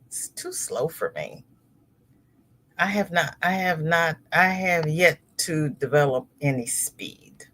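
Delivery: ordinary delivery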